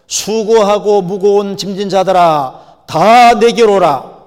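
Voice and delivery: loud, emphatic sermon delivery; full-force preaching. Real, not acted. Preacher urging people to grasp God's love, full-force preaching. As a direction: drive each phrase home from the pulpit.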